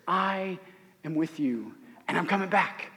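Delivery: hushed promise. Acted. Jesus voiced, voice drops to hush.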